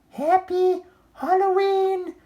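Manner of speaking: very high, loud, gushing mom voice; doting mother. Acted. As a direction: coo it like a proud mother. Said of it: "said Oscar's mom".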